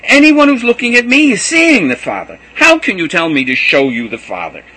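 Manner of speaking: loud high exasperated pleading throughout; impassioned cry. A narrator's reading. Jesus pleading with disciples, loud, high and impassioned. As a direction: press every line with passion. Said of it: "cried Jesus".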